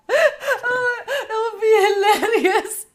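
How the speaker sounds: high, loud words through laughter; real helpless laughter, stretched out; laughing hard. Real, not acted. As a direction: barely get it out for laughing. Cracking up at the story.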